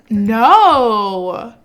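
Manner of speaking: loud shocked shout. Real, not acted. Gamer seeing a sibling flirt with the husband, shocked shout. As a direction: yell it in disbelief.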